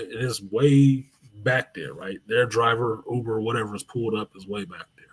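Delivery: ordinary delivery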